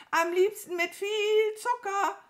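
loud, high crow call; high-pitched bird holler; calling after. A narrator's reading. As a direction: shout it after someone leaving. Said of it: "rief die Krähe ihr nach".

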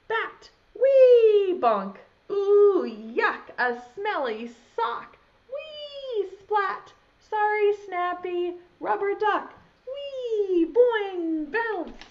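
high, loud playful sound words; excited sound effects. Acted. Reading to children, excited whoops as things fly. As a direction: bounce each word up like a toy flying.

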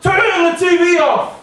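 mother ordering her son; mum calling out. Retold or imitated. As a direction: call out firmly from the next room. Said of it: "my mum came out and said".

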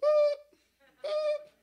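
high reversing beeps; squeaky beep-beep of a vehicle in reverse; beeping truck noise. Pretend. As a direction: mimic a truck backing up.

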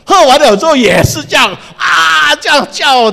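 high, loud shouted telling; dramatic shout. A narrator's reading. Preacher acting out the second horse's scream, shouting high.

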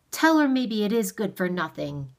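understated indignant retort; angry order. A narrator's reading. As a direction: order it with a touch of anger. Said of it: "awe vanished in anger".

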